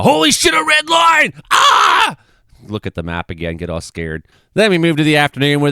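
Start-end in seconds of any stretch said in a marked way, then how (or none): 0.0-2.2 high shouted fake panic; mock panic. Mocking viewers who get scared by the map, loud fake panic. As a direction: shriek at the red line like it's doom.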